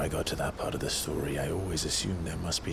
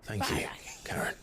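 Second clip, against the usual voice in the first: whispered thanks; 4 semitones higher than usual. Acted. Quiet thanks to the old woman.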